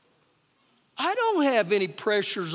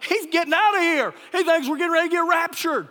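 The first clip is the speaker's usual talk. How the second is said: louder, higher, quick exclamation; mock alarm; 5 semitones higher, 6 dB louder, faster than usual. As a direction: burst out as if he's fleeing for the rapture. His exclaimed thought about the man.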